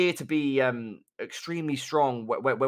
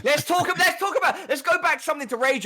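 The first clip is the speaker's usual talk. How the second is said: mock rant, pitched way up and loud; exaggerated rage; 13 semitones higher, 4 dB louder than usual. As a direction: launch into a hammed-up rage. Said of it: "All, all jokes aside now about the exaggerated rage".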